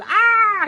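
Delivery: very high, very loud scream; hammed-up shriek of terror; terrified scream. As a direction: scream at full volume in mock horror. Ted screams, terrified of baby.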